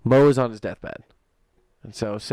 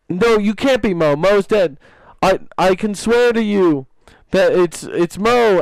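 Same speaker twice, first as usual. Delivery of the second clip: higher, louder acted dialogue; character voices; 7 semitones higher, 5 dB louder than usual. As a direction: act out both friends. Two characters argue in joke.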